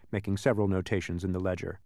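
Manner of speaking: ordinary delivery, nothing marked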